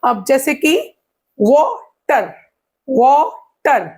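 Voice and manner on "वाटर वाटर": "Water" as two separate beats, वा, टर; split into syllables. Shown as a demonstration. Teacher showing two syllables to students, water broken in two. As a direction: break "water" after वा with a short pause. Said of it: "ये शब्दों को तोड़ रहा है".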